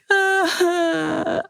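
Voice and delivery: high broken sob sound; crying sob. Real, not acted. Overcome in front of the camera, a high crying uh. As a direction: let a teary uh break out. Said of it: "And I started crying".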